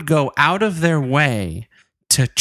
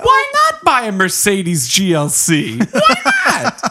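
hammed-up reckless enthusiasm, leaping high at the end; mock a silly motto, squealing the last words; mocking exclamation; 3 semitones higher than usual. Host mocking a rash rationale, high mock enthusiasm. Mocks the motto with absurd example.

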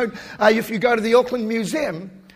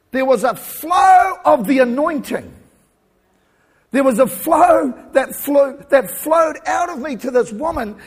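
full-force preaching; 4 semitones higher, 6 dB louder than usual. Acted. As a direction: preach the anointing to the congregation.

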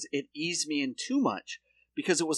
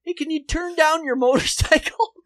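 mock polite neighbor; 11 semitones higher, 6 dB louder than usual. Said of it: "he's like".

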